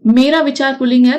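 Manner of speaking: ordinary delivery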